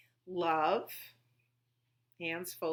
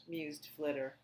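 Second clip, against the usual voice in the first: usual speaking voice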